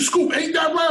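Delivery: retelling an angry crew's yell; mock shout. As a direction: imitate the band shouting for agreement. Retelling the band's angry demand.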